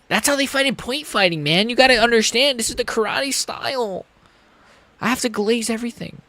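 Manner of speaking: high, hyped-up commentator gushing over karate; mock podcaster glazing the fighting style; Joe Rogan impression. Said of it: "Sorry, Joe".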